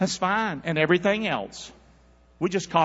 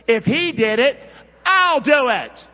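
louder, higher, slower refrain; loud repeat; 12 semitones higher, 12 dB louder, slower than usual. Shown as a demonstration. Preacher and church saying refrain together, loud. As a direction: belt it back with the church. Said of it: "Say that with me".

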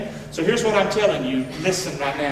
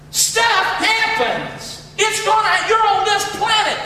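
full-force preaching shout; 11 semitones higher, slower than usual. Acted. Preacher rallying the congregation to faith, shouting. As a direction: shout it to the back pews.

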